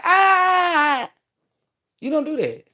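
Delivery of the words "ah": high, loud put-on shriek of fright; mock frightened scream. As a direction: shriek like someone spooked by love. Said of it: "I'm not gonna be like".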